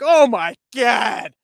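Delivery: slow, loud, drawn-out horrified exclamation; disgusted shout. Retold or imitated. Men at the urinal react to a stinky guy, shouting in disgust. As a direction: recoil in loud disgust at the smell. Everyone's going like.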